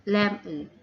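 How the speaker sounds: "lemon" as LEM-un; weight on "lem", weak schwa ending in "lemon"; stress on first syllable. Shown as a demonstration. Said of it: "stress falls on the first syllable".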